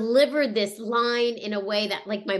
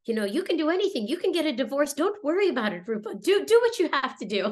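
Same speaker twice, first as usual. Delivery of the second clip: higher, quickened voice of a supportive mother; imitating mom, pitched up and insistent; mother's reassurance; 5 semitones higher, faster than usual. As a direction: urge her on in a lively quoted voice. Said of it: "and she's like".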